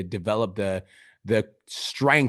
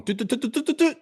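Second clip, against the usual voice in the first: high, rising doot doot alarm sound; mock alarm; 14 semitones higher than usual. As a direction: chirp it like a warning beeper, way up high. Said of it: "whenever shit starts going".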